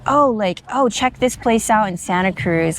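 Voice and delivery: ordinary delivery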